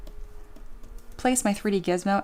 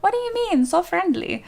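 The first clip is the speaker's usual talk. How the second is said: much higher, hammed-up disbelief; mock surprise; 10 semitones higher, 4 dB louder than usual. To chat, defending her angry rat in mock-shocked high pitch. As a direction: pretend to be shocked he's not cute.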